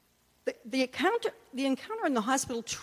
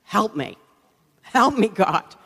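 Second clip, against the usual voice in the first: desperate prayer; 9 dB louder, slower than usual. Retold or imitated. Pastor reenacting his hot prayer: desperate, louder plea. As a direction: plead with God out loud, all at once. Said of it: "a desperate prayer to God".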